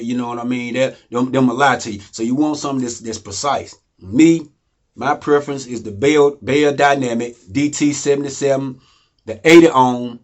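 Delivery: usual speaking voice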